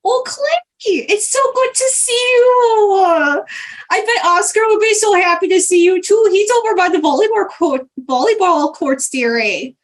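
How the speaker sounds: high, stretched-out hello running into eager chatter; Greta's gushing greeting. Acted. Greta spots Clay out in the water, gushing. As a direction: coo very high, drawing out the greeting. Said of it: "she sees Clay out in the water and goes".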